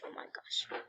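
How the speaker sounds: breath-only whisper, very soft; awed whisper. A narrator's reading. Storyteller closing on a cliffhanger, awed whisper. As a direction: whisper it in dread.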